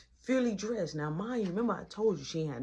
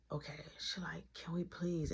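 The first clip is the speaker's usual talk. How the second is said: soft retold plea; 5 semitones lower, 11 dB quieter than usual. Girl leaning in for kiss.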